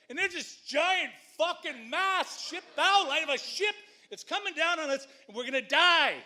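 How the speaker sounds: high, loud panicked retelling that builds to a shout; rising panic. Retold or imitated. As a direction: relive the terror as the ship bears down. Spotting a ship about to hit.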